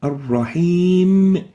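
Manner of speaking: Ar-Rahim slow, meem held and sounded; correct Ar-Rahim. Shown as a demonstration. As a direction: draw out Ar-Rahim, meem not cut short. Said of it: "the right way of pronouncing it here is".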